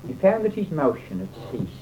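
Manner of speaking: usual speaking voice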